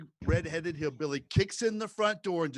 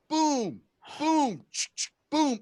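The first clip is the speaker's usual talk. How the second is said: loud, higher mock gunshot booms; shotgun booms; 11 semitones higher, 5 dB louder, much slower than usual. Retelling the night Scott's dad fired his gun, mock booms. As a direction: bark out each shot like a shotgun blast.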